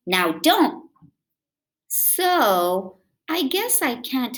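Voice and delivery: put-on lively read-aloud for kids; storybook reading. A narrator's reading. Reading a picture book aloud to children, lively.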